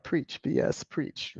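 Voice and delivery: hushed whisper imitating a supporter; whispered cheering-on. Reading Wendy's chat comment aloud, whispered mock cheering. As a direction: whisper it like an amen from the pews. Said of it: "Wendy's like".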